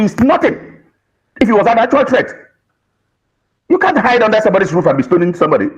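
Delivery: usual speaking voice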